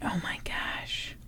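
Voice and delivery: breathless whisper. Real, not acted. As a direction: whisper it in awe. Reacting to the story.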